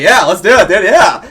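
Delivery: loud, high eager yes with a laugh; an excited kid shouting yes; thrilled yes. Retold or imitated. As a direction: jump at the invitation, bursting with glee. Teen thrilled at tour bus invite.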